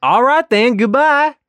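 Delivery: high, loud put-on goodbye. Job applicant says goodbye in a high strained put-on voice. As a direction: sing out a strained cheery goodbye.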